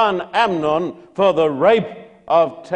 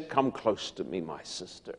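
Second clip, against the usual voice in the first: luring murmur; 8 semitones lower, 13 dB quieter than usual. Acted. Amnon luring Tamar alone.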